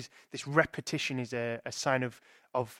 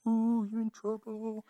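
a slow, high, sing-song ooh, teasing; teasing disciple; 7 semitones higher, 3 dB quieter, slower than usual. Retold or imitated. Disciple at the back teasing Martha, slow high sing-song ooh. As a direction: play the disciple at the back who relishes her getting told off. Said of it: "One of the disciples in the back".

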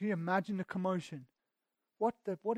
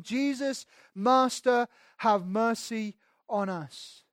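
high, loud pleading cry; desperate cry for mercy; 4 semitones higher, 6 dB louder, slower than usual. Retold or imitated. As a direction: call out to Jesus from afar, begging. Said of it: "lifted up their voices, saying".

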